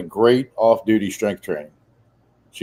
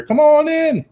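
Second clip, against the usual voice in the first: put-on very high squawked old-man call; parrot mimicking old man; slower than usual. Delivery guy retells the parrot's call, high squawk. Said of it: "like an old man's voice".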